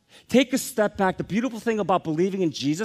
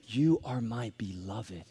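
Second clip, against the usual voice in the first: tender; 7 semitones lower, 7 dB quieter, slower than usual. Retold or imitated. Jesus's words quoted tenderly.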